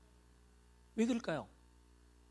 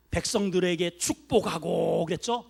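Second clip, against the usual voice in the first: loud preaching; 7 dB louder than usual. Acted. Preaching at full force.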